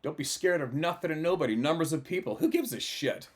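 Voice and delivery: loud, high-pitched pep talk; fired-up, emphatic urging; rousing exhortation. Real, not acted. Rallying listeners after the story.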